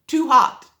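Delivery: plain reading voice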